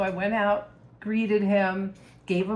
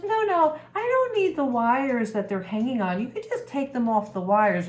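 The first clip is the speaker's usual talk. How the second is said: high insistent start, then settling; lightly voiced pushy woman buyer; 5 semitones higher, 4 dB louder than usual. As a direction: imitate an eager woman brushing off a refusal.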